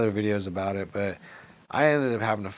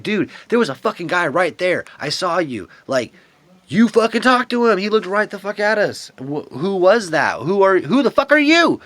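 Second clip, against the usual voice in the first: much higher, much louder accusing yell at her; retold yell; 9 semitones higher, 9 dB louder than usual. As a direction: shout it like you've caught her lying. Retold shouting at her, very loud.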